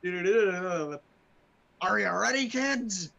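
very slow, loud captain call. Pretend. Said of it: "I just read that wrong".

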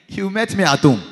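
loud, high-pitched defiance; preacher's forceful challenge to Satan; defiant comeback. Acted. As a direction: throw it boldly at the devil.

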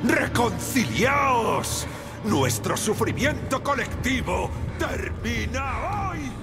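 slow booming PA voice; echoing loudspeaker proclamation; distorted announcement. Acted.

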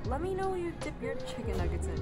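ordinary talk

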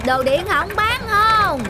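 high drawn-out hawker's call, slow; street vendor call. Acted. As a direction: sing it out like a scrap buyer down the lane. Scrap buyer calling through street. (tiếng rao kéo dài của người mua đồ cũ)

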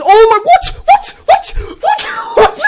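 high, loud repeated what; shocked shouting. Real, not acted. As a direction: yell in disbelief. Another rare catch shock.

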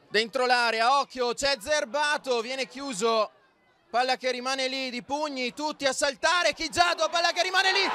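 mounting frenzy in a goalmouth scramble; building excitement. Real, not acted. Last-minute scramble in the box.

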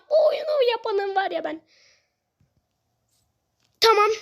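usual speaking voice

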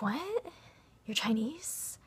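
high, rising incredulous "What?"; mock surprise. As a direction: imitate a baffled friend's astonishment. Friend's disbelief acted out.

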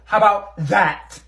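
loud, slow, high how about that; mocking retort. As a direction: fling it back at her. Storming off after argument.

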